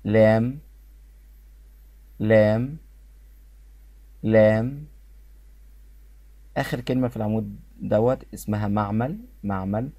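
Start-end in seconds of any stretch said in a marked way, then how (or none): 0.0-4.9 لام said loud as a model word, lifting at the end; pronunciation drill. Shown as a demonstration. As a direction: model لام clearly for repeating, louder, with a rising end. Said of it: "ما تنطقش البي".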